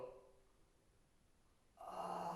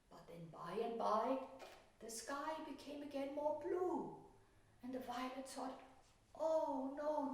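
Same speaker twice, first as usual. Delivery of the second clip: higher, gentle storytelling voice; story narration; 8 semitones higher than usual. A narrator's reading. Painter telling a children's story while painting. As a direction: tell it like a bedtime story for children.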